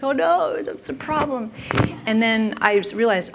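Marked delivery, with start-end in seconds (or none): 0.0-1.5 alarmed. Retold or imitated. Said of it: "I was like".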